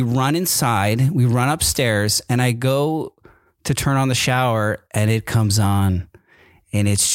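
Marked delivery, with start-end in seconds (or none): none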